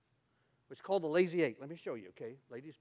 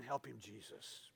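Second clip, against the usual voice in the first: church lady plea; 7 semitones lower, 13 dB quieter, slower than usual. Retold or imitated. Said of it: "And they were screaming".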